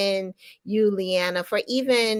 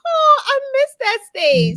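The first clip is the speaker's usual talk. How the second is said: high wistful gush; 14 semitones higher, 7 dB louder than usual. Retold or imitated. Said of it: "I'm just like".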